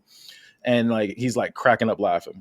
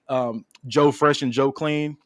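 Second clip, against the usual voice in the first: ordinary talk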